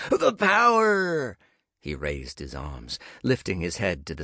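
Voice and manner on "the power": The power! wailed much higher and very slow; mock dramatic cry. Nick mocking talk of power, building hammed-up cry. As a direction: stretch The power! into a soaring, hammed-up wail. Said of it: "Nick's mock dramatic cry".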